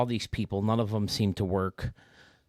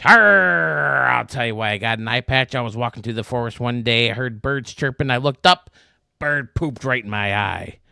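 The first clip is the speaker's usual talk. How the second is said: loud hammed-up pirate, building louder; loud pirate growl telling the tale; 10 dB louder than usual. Pirate in the joke explains the eye patch, loud hammed-up pirate. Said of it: "pirate answer in joke".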